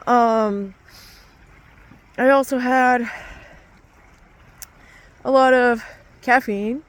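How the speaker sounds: ordinary delivery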